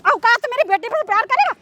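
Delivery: outraged mother's tirade; scornful, indignant scolding; angry scolding. Acted. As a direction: berate him with indignant questions. Acted mother challenges servant.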